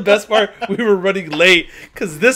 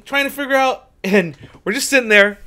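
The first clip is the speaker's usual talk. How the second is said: ordinary delivery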